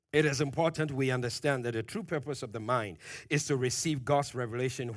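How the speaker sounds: ordinary talk, nothing marked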